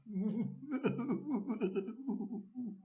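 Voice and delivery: high nasty snicker; a wicked gleeful cackle; horrible grin laugh. Shown as a demonstration. Reader showing a horrible grin, high nasty laugh. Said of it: "That's my best horrible grin".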